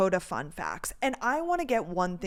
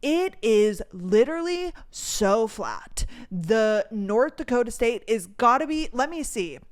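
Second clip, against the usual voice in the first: normal talking voice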